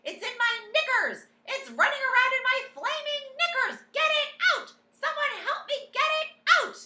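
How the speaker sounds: high shrieking panic; frantic loud shrieks; screaming. A narrator's reading. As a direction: scream it hysterically. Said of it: "the man was screaming".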